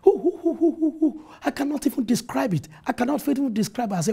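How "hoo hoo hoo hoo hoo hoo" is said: put-on excited child's hooting. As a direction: hoot like a thrilled little boy waking up. Boy keeps hooting with excitement.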